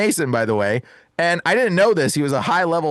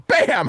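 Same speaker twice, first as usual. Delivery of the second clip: high, sudden impact noise; imitated hammer blow; 11 semitones higher than usual. Smashing the mason ring with a hammer, sudden bam. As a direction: punch it out like a hammer strike.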